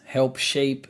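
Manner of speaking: usual speaking voice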